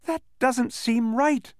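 high, slow, helpless protest. A narrator's reading. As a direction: protest slowly, at a loss. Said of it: "said Milo helplessly".

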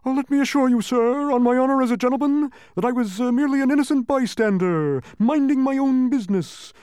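very high, loud flustered protest; nervous humbug. A narrator's reading. Startled humbug assuring the officer of his innocence, nervous bluster. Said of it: "nervously replied".

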